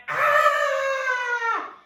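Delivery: loud, high, bad-day ah; frustrated shout. Teacher voicing a bad day's release, a loud frustrated ah. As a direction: scream the bad day out in one ah. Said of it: "if you've had a really bad day".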